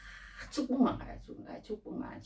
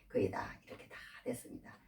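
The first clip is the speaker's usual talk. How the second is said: whispered aside; 7 semitones lower, 11 dB quieter than usual. Real, not acted. Unvoiced aside.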